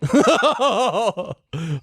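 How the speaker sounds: high laugh. Real, not acted. Laughs at co-host's joke.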